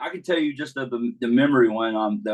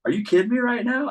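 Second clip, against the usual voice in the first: high-pitched mock outrage; incredulous, pitch shooting up; exasperated disbelief; 13 semitones higher than usual. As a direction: imitate an umpire losing it. Umpire who lost it, acted.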